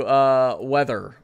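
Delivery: normal talking voice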